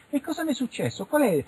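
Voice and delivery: high-pitched questioning woman's voice; imitated witness. Witness asking investigators what happened, high imitated lady. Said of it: "Ma la signora fa".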